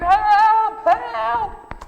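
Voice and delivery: high, loud cry of help; scream for help. Shown as a demonstration. Children's talk leader screaming from a pit, loud desperate cry. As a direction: yell it like someone stuck in a hole. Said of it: "I would scream".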